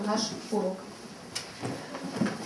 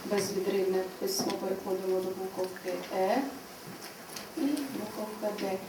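quiet dictation; 2 semitones lower, 9 dB quieter than usual. Shown as a demonstration. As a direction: murmur the strokes while they trace. Teacher quietly dictates letter strokes. (тихо, спокійно диктуючи)

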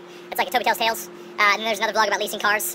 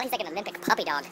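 normal talking voice

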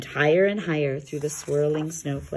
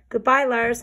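calling goodbye; 6 semitones higher than usual. Acted. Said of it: "cried Yuri".

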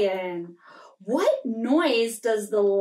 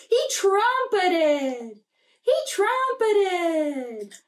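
higher, slow drawn-out trumpeting call; elephant trumpet; 6 semitones higher, much slower than usual. Pretend. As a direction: stretch it like an elephant's trunk call. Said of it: "this is what he said".

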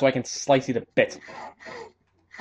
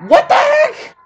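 frustrated shout; 10 dB louder, slower than usual. Real, not acted. Frustrated gamer yelling at game.

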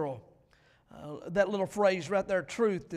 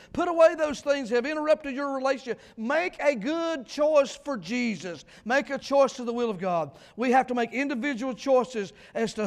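higher, louder pulpit preaching; preacher exhorting his church at full force; fiery preaching; 6 semitones higher, 8 dB louder than usual. Acted. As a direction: drive each point home to the congregation.

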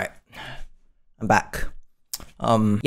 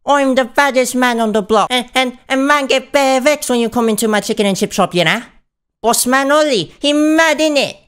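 put-on London roadman accent; 6 semitones higher than usual. Roadman slang talking to rapper.

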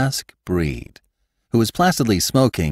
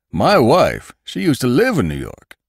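higher, louder gossipy landlord voice; Jacobus, landlord; 7 semitones higher, 5 dB louder than usual. Acted. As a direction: play the nosy old landlord, pitched up.

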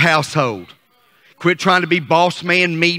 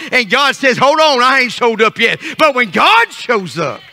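insistent, raised pulpit voice; fervent revival cadence; driving preaching; 6 semitones higher, 4 dB louder than usual. Real, not acted. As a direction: drive each phrase at the congregation.

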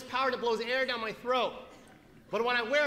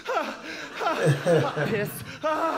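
loud, mock gasping awake; startled gasps; 5 semitones higher, 5 dB louder, much slower than usual. Patient woken by doctor kicking the door, gasping. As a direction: blurt gasps as if jolted from sleep.

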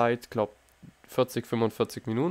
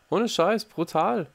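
high, amazed outburst; 8 semitones higher, much slower than usual. Real, not acted. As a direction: marvel at it. Staunt über Fahrlehrer. (hoch und staunend, ungläubig)

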